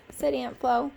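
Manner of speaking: ordinary delivery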